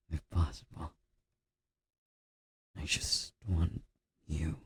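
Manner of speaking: normal talking voice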